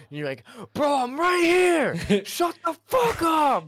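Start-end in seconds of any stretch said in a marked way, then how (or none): 0.7-2.2 high, loud, drawn-out shout; imitated exasperated yell. Imitating Kane answering Jake's nagging, exasperated yell. Imitating friend's angry reply. 2.2-3.7 very high, loud, stretched-out shout; imitated furious yell. Imitating Kane blowing up at Jake, furious yell. Imitating friend's angry reply.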